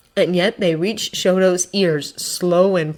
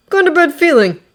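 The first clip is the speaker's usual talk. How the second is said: high, raised, uneasy; tense, tight voice; 10 semitones higher, 6 dB louder than usual. A narrator's reading. Kirishima warns the others of danger, tightly. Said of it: "Kirishima says tightly".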